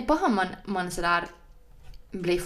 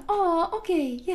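high, sugary business-phone voice; 10 semitones higher, slower than usual. Retold or imitated. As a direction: imitate an overly nice PR woman, pitched way up. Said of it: "hur var den då".

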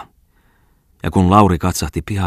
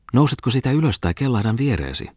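teasing woman; 7 semitones higher than usual. A narrator's reading. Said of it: "jatkui Aina Kustava".

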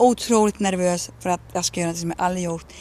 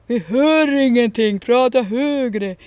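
called from the back; 2 semitones higher, 4 dB louder, much slower than usual. Retold or imitated. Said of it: "så ropar han längst bak".

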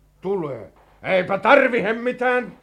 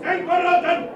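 high, drawn-out battle roar; 10 semitones higher than usual. Acted. Colonel leading the rebel charge with promises of promotion, roaring. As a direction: bellow marching orders over the fighting. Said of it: "Tuo karjunta".

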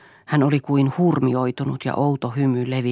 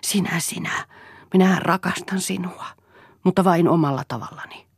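ordinary delivery, nothing marked

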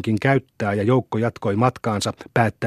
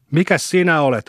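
higher-pitched challenge; understated threat in a raised pitch; threatening retort; 5 semitones higher, 3 dB louder than usual. A narrator's reading. Opponent answers Vesa's insult, threatening. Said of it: "uhkasi siihen jo vastatappelia".

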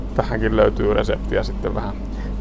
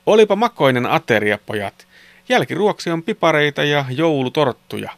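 higher, livelier storybook mother; mother speaking; 4 semitones higher than usual. A narrator's reading. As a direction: announce it to the boys as their mother. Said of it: "äiti sanoi". (äidin eloisa, korkeampi ääni)

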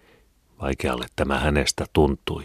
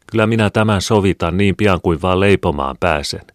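raised, higher voice carrying after her; calling after someone; 5 semitones higher, 6 dB louder than usual. A narrator's reading. Mother promising to repay the departing neighbour, calling after her. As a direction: call out a promise to someone leaving. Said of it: "puhui Mari hänen jälkeensä".

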